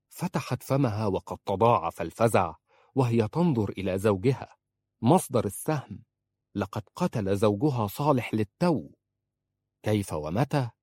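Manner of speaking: usual speaking voice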